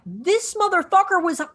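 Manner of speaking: high, laughing disbelief; amazed mock outburst with a laugh; shocked realization. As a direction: gasp at the hidden major. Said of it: "you're like".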